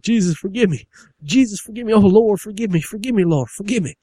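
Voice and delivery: high, loud desperate wailing; hammed-up pleading son; begging forgiveness. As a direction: cry out to the Lord for mercy. Whipped son begs forgiveness.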